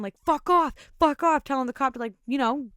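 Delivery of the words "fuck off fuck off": mildly raised, mock yell; retold yelling. Husband yelling at the clown to back off, mock yell. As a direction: imitate a protective yell, louder and higher but held back. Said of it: "he kept yelling".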